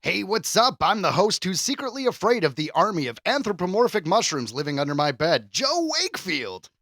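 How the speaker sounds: ordinary delivery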